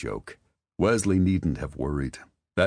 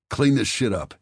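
a touch louder, a snarled order; 8 semitones higher, 6 dB louder than usual. A narrator's reading. Son orders his mother to clean up, snarling. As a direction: snap it at his mother like a warning. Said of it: "he snarled".

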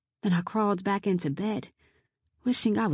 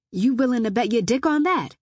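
louder, higher, daring him; raised, combative question; defiant challenge; 4 semitones higher, 5 dB louder than usual. Acted. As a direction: dare the intruder to test you. Defiant threat back at the intruder.

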